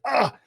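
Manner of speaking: high-pitched mock startled "ah"; startled gasp. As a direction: imitate a sudden fright. Hero startled by the woman.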